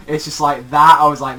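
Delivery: normal talking voice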